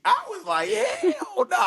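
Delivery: high laughing hell nah; laughing refusal. Retold or imitated. Fighter recalling the weight cut, laughing high refusal. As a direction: squeal it out through laughter.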